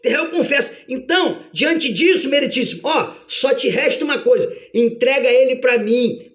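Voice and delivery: gloating, insistent demand; Satanás. Acted. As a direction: press the accusation eagerly to the judge. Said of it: "E aí Satanás".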